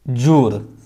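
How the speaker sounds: ordinary delivery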